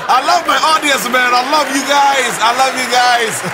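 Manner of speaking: gushing affection. Real, not acted. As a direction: gush loudly to your audience, stretching the words. Gushing to audience after jingle.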